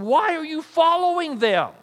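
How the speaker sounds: loud and high, beside himself; exasperated cry. Retold or imitated. Paul pleading with the Corinthians, exasperated and loud. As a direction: cry out in disbelief at the church. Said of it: "he's beside himself going".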